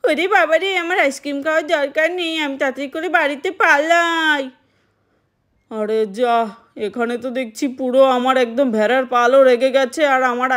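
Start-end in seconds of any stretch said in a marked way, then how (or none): 0.0-4.4 put-on higher kid voice, a touch of panic; little boy deciding to run home; scared child.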